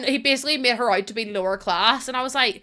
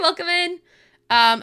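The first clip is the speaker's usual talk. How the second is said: ordinary delivery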